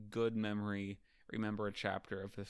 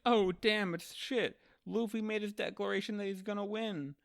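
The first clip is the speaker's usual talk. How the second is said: high, excited retold crowd reaction; mock astonishment; 11 semitones higher than usual. Everyone reacting to Luffy's declaration, mock astonishment. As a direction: gasp it out like amazed onlookers, hammed up.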